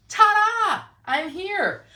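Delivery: bright, high ta-da as she pops back on camera; a big excited reveal; cheery showy ta-da. Real, not acted. Reveal after flipping phone.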